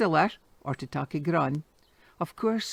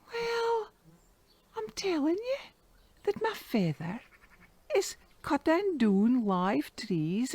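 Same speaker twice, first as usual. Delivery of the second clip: put-on boy's voice; 6 semitones higher than usual. Boy answers shaken moneylender.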